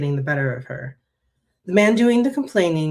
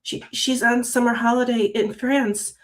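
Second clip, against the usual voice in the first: higher-pitched, nervous shop worker; 7 semitones higher than usual. Acted. Shop worker answering a displeased customer, nervous. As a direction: stammer the start, voice lifted with nerves. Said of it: "the shop worker asked nervously".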